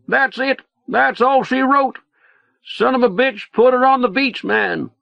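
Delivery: high shouted orders; shouting. A narrator's reading. Leo orders his man to run the boat aground, high shouted command. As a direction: bark the order to beach her. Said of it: "Leo is shouting".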